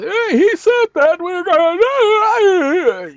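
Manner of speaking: high, loud mocking voice, dragged slowly; stretched-out sneering impression of a viewer; hammed-up high whine of a doubter. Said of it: "you're gonna say".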